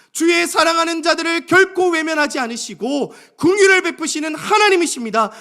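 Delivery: high, loud, sustained declaration; proclamation of faith. Acted. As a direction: preach it out with full conviction, building in force. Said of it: "하나님을 향해 믿음으로 선포하는 거예요". (믿음으로 높고 힘차게 선포)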